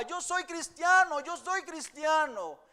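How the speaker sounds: loud, high shout of "soy cristiano"; a man shouting his faith. Retold or imitated. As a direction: yell it proudly to the crowd. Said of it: "un hombre gritaba".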